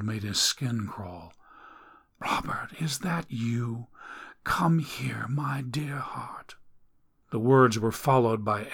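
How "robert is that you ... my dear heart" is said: slow, hushed, eerie whisper; a dying woman beckoning softly. Acted. Dying woman calls from bed.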